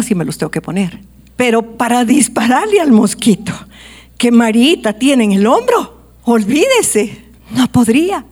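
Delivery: fervent sermon voice; preaching. Acted. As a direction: drive the message home to the congregation. Preacher presses the point louder.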